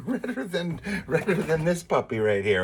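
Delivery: words broken by real laughter; laughing. Real, not acted.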